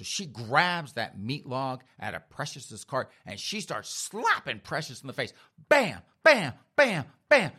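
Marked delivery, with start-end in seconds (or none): 5.7-7.5 loud, high, falling bams; shouted blow sounds. Acted. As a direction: punch out each hit like a cartoon fight. Mimics slapping with meat log.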